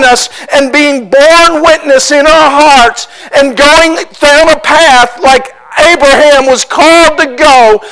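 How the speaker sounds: loud, high, driving sermon voice; full-force preaching. Acted. Pastor urging the congregation to follow God's leading, preaching at full force. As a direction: preach it out, swelling with conviction.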